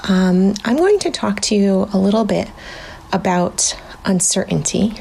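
ordinary talk, nothing marked

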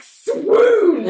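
high, loud, drawn-out squeal of adoration; theatrical lovestruck cry; gushing swoon. Retold or imitated. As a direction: swoon over a crush, hammed up. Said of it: "I was like".

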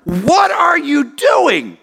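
high, slow shocked shout; freaking out. Retold or imitated. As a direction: draw out a horrified father's cry. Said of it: "He freaks out".

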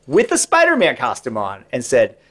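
normal talking voice

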